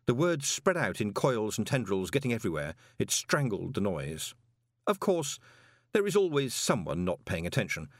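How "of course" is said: plain reading voice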